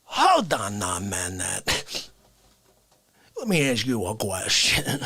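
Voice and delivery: a deep-voiced man scolding the host; deep indignant caller.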